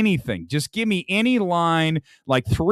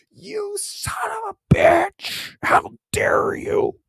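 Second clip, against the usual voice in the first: mock anguish; much slower than usual. Mock fan screaming.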